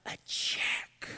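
whispered revelation; hushed reveal. Retold or imitated. Grandpa revealing what fell out, hushed whisper. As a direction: whisper the surprise.

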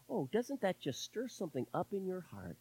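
soft, dry sarcasm; hushed mock-moved question to the room; sarcastic. Real, not acted. Preacher mocking a liberal's claim to his church, sarcastic. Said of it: "Excuse me for my sarcasm".

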